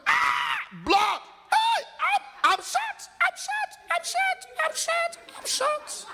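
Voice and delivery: extreme high shrieking I'm shot; hysterical panic. Retold or imitated. Shot man realizing he is hit, shrieking hysterically. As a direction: scream it in terror, over and over. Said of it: "you say".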